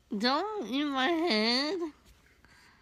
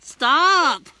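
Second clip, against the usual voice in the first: loud sharp stop; shouting stop; 12 dB louder than usual. Real, not acted. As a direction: yell at them to stop. Pleading during play fight.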